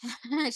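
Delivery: brief low chuckle; short amused laugh. Real, not acted. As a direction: let out a small laugh. Amused at sounding like a snake. (ছোট্ট হাসি)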